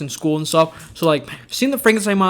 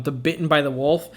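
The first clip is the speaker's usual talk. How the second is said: ordinary delivery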